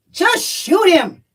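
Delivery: high, drawn-out sarcastic quip; exasperated, slow mock suggestion. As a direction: toss it out sarcastically, stretching the words. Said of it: "like, sarcastically".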